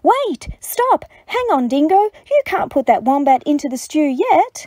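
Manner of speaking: high, loud cry to stop; squeaky, urgent protest; urgent alarm. A narrator's reading. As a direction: call out in storybook panic. Animals urgently stop Dingo.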